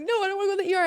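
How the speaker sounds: high, loud retold protest; panicked refusal. Choker refusing the bystanders before running off, panicked. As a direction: blurt out a frantic refusal.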